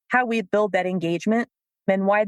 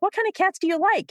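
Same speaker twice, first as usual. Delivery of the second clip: much higher, bright mock curiosity; feigned interest; 10 semitones higher than usual. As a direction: pretend to care only about cats.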